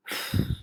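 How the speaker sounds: short, forceful exhale; blown puff of breath. Acted. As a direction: blow hard as into a trumpet. Blows the trumpet in story.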